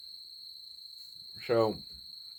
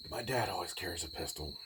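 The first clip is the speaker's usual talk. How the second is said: ordinary talk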